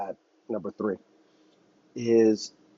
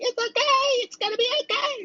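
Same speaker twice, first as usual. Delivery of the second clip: squeaky falsetto, way higher, mocking; mock Kamala. Mocking Kamala calming a sinking nation, falsetto. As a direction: chirp it like a cartoon cheerleader. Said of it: "Kamala's being like".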